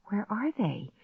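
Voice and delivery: soft, hushed self-question; asking myself. A narrator's reading. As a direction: murmur to yourself. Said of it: "I asked myself".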